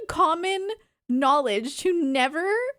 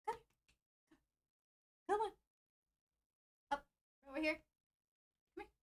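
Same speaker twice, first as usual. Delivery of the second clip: calling the dog; 3 semitones higher, 13 dB quieter, much faster than usual. Real, not acted. Calling dog onto lap.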